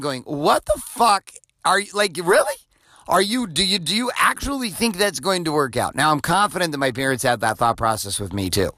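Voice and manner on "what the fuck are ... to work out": incredulous, pitched-up what the fuck; like a father who can't believe what he's hearing; exasperated disbelief. Retold or imitated. Father to teenage son, exasperated disbelief.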